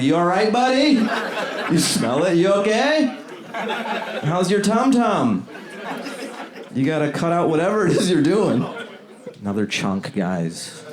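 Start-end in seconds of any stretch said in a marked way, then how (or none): 0.0-8.6 mock concerned neighbor. Talking through the wall to a neighbor, hammed-up concern. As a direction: check on your neighbor with mock sympathy. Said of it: "You're like".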